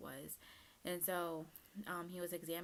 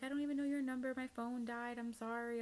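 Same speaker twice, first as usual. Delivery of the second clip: higher, flustered apologetic voice; much higher, sheepish self-quote; retold apology; 7 semitones higher, 4 dB louder than usual. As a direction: replay yourself apologizing to mom in a rush. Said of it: "And I was like".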